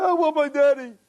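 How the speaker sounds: put-on high-pitched wailing child; acted bedtime tantrum of a tearful kid; crying little boy. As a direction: imitate a small boy bawling, voice shooting up. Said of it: "the little boy starts crying".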